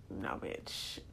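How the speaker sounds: breathless low whisper of refusal; whispered mock refusal. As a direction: hiss it out like a sulking body. Body refusing, voiced as quote.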